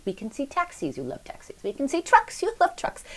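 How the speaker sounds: put-on high, singsong coaxing to a toddler. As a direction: lift the voice high to lure a little boy along. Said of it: "And I said".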